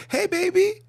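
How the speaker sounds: high, singsong doting dad; sugary high-pitched parent greeting; father greeting daughter. Acted. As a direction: coo a hello to a little girl. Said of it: "Father come to the daughter".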